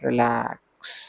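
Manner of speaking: soft, long-drawn relax, dying away; soothing relax cue. Real, not acted. Yoga teacher easing listeners' toes loose, soft drawn-out cue. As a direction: breathe relax out slowly and softly.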